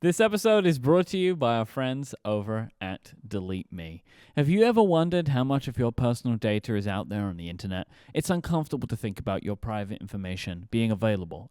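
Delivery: measured, scripted sponsor read; podcast ad read. Real, not acted. Host telling listeners about a sponsor, polished ad read. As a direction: deliver it as a clear host-read ad.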